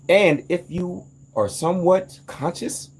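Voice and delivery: usual speaking voice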